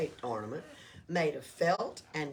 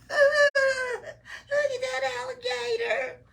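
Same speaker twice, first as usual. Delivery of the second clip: high, loud elf voice, laughing; mocking elf; 11 dB louder than usual. Elf teasing Oscar from the tree, high and loud. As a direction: jeer like a giggling little elf.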